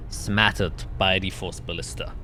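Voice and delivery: normal talking voice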